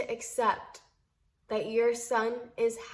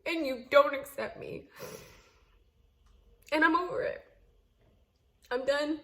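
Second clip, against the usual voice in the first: raised appeal; 5 semitones higher than usual. Real, not acted. A wife telling her mother-in-law she has tried, voice raised and hurt. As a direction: speak straight to her, heartfelt and fed up.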